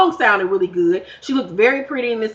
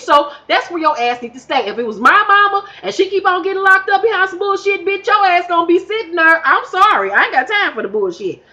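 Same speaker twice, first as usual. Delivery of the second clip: heated rant; 8 semitones higher than usual. Real, not acted. Ranting about the mother.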